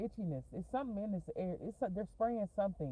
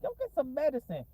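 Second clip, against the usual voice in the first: exasperated schoolboy jab; 11 semitones higher, 4 dB louder than usual. Retold or imitated.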